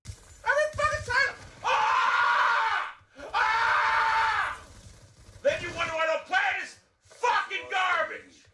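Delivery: yelling at the game in frustration; furious shouting rant; raging gamer. Real, not acted. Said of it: "rage gamer".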